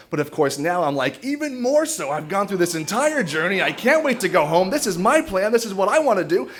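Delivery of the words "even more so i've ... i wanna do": high-pitched mock rant; crazy-person rant. Boyfriend refusing to spend the night, mock-crazy ranting. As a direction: act out a worked-up, higher-voiced insistence. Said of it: "I sound like a crazy person".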